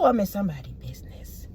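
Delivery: quick breathy whisper under the breath; a fast, secretive whisper; whispered aside. Real, not acted. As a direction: lean in and whisper it fast, like gossip. Aside about prying into others' business.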